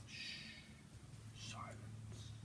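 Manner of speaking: breathy whispered single word. Shown as a demonstration. Streamer whispering to the viewers for silence, whispered. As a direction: hush them with a whisper. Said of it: "Let me whisper".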